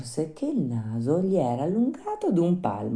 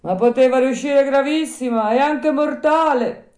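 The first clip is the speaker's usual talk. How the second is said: put-on higher, louder old man's voice; voiced old man, raised pitch; little old man; 12 semitones higher, 7 dB louder than usual. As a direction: tell the news as a village old man. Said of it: "rispose il vecchietto".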